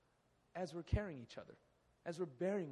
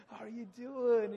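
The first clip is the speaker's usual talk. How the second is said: higher, louder, slow loaded question; hammed-up pastor question; 6 semitones higher, 10 dB louder than usual. Pastor asking young Billy, slow loaded question, higher and louder. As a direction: draw it out like a pastor with an agenda.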